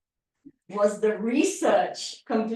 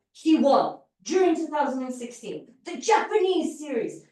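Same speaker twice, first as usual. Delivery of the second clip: during pushed up and Japanese lifted high; reordered demo with emphasis on during and Japanese; stress on during; 4 semitones higher, 3 dB louder than usual. Shown as a demonstration. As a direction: lean on the word during, then lift Japanese. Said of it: "put an emphasis on whatever you want".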